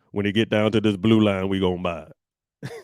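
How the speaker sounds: low, put-on money-talk voice, smooth and sure; millionaire TV voice. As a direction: play the slick TV millionaire explaining the red line. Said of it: "why did I use all the voices".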